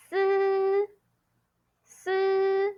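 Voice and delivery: "s" with a buzzed vowel held high and level; first tone. Shown as a demonstration. Teacher reviewing S and S I, long high level "s". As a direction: stretch "s" on one high note. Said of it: "Bring it to first tone".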